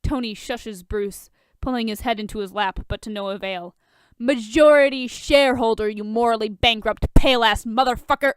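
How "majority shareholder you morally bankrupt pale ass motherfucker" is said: much louder furious insults; drunken yelling. A narrator's reading. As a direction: bellow it at him. Drunk Bruce yelling insults at Lex.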